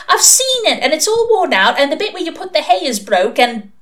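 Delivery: put-on high, loud child's tirade; snappy accusation. A narrator's reading. Little sister exposing Pepper's worn-out toy, snappy. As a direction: rattle off the evidence crossly. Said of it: "snapped her sister".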